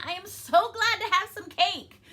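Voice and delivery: high, loud exclamation; great excitement. Shown as a demonstration. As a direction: read it with a big burst of excitement. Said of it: "like, an exciting thing".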